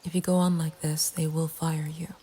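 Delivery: usual speaking voice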